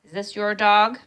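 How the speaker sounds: put-on a giant stranger glowering down at a boy; scowling stranger. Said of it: "He stood there scowling at me and said".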